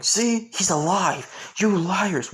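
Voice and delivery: the surgeon triumphantly scolds the nurses; vindicated scolding. Acted. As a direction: rub it in the nurses' faces.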